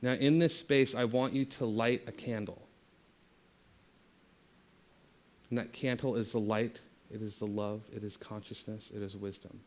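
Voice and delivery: guided meditation. Acted. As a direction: ease them inward, letting the voice sink and soften. Leads a meditation.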